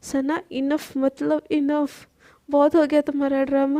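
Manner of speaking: ordinary delivery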